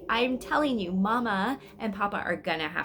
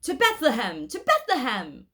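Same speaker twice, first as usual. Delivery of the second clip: loud rallying call; a shepherd's ringing cry; 5 semitones higher, 6 dB louder than usual. Acted. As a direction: call the flock onward, loud and high. Shepherd calls out to flock.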